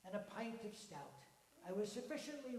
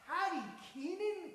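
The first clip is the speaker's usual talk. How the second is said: astonished; 10 semitones higher, 8 dB louder than usual. Acted. Ron repeats in astonishment.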